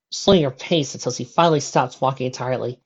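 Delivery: usual speaking voice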